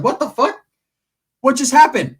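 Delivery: high, loud, stunned disbelief; shocked. Retold or imitated. Narrator reacting to aunt's sudden change, stunned. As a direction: react like you can't believe it. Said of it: "I'm like".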